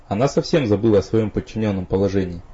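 ordinary delivery, nothing marked

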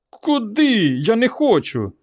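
put-on very high, frightened boy's protest; high whiny child; whining refusal. A narrator's reading. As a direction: balk in a high, pleading voice.

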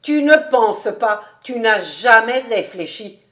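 loud, animated reproach; vehement vivacity. A narrator's reading. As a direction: press the accusation energetically. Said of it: "poursuivit David avec une vivacité singulière".

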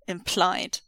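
ordinary delivery

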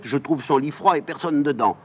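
put-on suspicious old nurse; scolding old nurse. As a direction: chide the girl like her old nurse.

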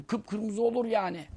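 normal talking voice

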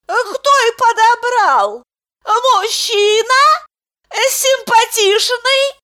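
very high, slow eager asking; eager questions. A narrator's reading. Lyudmila eagerly asks about the man. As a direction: ask with curious excitement.

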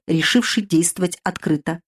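ordinary delivery

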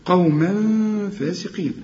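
قوما with a held nasal hum before فاسقين; hidden-noon ghunna. Shown as a demonstration. Teacher models the verse, hidden-noon ghunna. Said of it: "هنا في غنة برضو".

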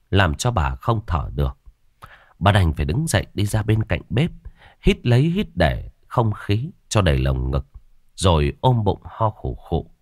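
ordinary delivery, nothing marked

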